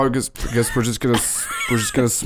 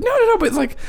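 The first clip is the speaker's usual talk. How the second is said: put-on very high, small kid voice; high-pitched flustered kid; kid character. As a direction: squeak it out up high, slowing as you go. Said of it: "And the kid's like".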